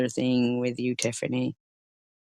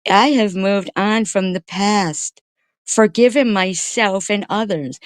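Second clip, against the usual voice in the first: higher, slowed, sneering sing-song; mocking sing-song; 8 semitones higher, 3 dB louder than usual. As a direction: parrot her pious words back mockingly. Mocking someone's self-help words.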